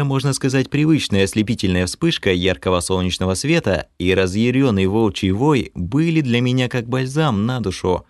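ordinary delivery

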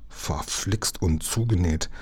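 slow, soft curse under the breath; quiet, drawn-out swearing; through gritted teeth. A narrator's reading. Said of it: "stieß Lukas zwischen den Zähnen hervor".

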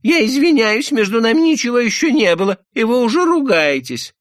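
very high, loud indignant squeal; обиженная Шура. A narrator's reading. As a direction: protest shrilly as an offended woman. Offended Shura protests. (обиженный визгливый протест)

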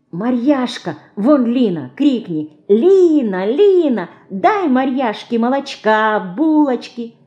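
ordinary delivery, nothing marked

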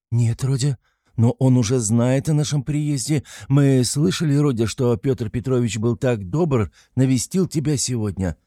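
mildly timid, anxious to please; somewhat timid. A narrator's reading. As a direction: add it with a touch of timidity. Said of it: "с некоторой робостью прибавила Пульхерия Александровна".

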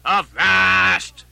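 loud, drawn-out halt order; big captain's bellow; commanding cry. Acted. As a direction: stop the whole crew with one shout. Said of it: "cried Ahab".

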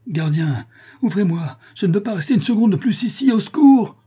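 high panicked shouting, building to a yell; terrified cry. A narrator's reading. Terrified bailiff running to the wicket, crying out for the guard. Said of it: "il s'écria en courant vers le guichet".

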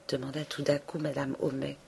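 plain reading voice

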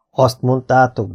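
each syllable tried carefully, slowly; sounding out a strange new word; slowly trying out the word. Acted. Old knight slowly tries out the new word demokrácia. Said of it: "próbálgatta az újonnan hallott szót kimondani".

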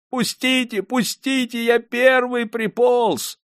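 высоко, жалобно, надрывно молит; тянет слова в отчаянной мольбе; мольба. A narrator's reading. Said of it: "молил". (калека из толпы отчаянно умоляет пустить его вперёд)

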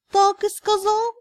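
high, slow, crestfallen question; sad. A narrator's reading. Cat asking heroine, sad and crestfallen. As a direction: ask with sinking hope. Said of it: "кот печально поинтересовался".

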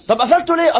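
sharp, loud, high angry question; angry demand. Retold or imitated. Scolding the late friend, loud and angry. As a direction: demand an answer, raising the voice.

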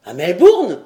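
loud, sharp query; startled question. A narrator's reading. Lord querying where the letter arrived, startled.

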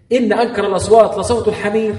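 high-pitched, emphatic recitation. Shown as a demonstration. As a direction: recite it high, as if raising your voice. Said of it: "اللي بعلي صوته كده".

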